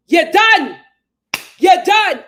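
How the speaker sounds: "done" shouted loud and high; shouted taunt. Real, not acted. Ranting vlogger shouting her cable company is finished, loud taunt. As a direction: yell it at the company like a verdict.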